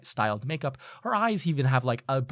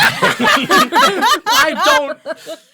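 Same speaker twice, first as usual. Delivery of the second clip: helpless high, loud laughter through the words; laughing hard; 15 dB louder than usual. Real, not acted. Game master reacting to a player's joke, laughing hard. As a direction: try to speak while cracking up.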